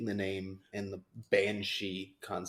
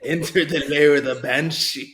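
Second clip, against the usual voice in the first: put-on Scottish accent; 5 semitones higher, 12 dB louder than usual. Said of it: "Can you say it like that Scare Actor did?".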